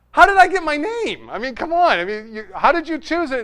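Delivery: high, loud, mock-frustrated demand; exasperated plea. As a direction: plead with mom for a straight answer. Retold exasperated plea to his mom.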